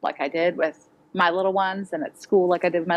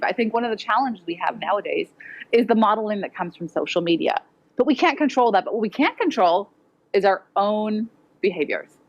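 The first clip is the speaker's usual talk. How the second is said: ordinary talk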